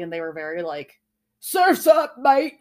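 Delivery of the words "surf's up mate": loud, high mock Aussie accent; Australian accent. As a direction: imitate boisterous Australians. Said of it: "The only Australians I've ever seen".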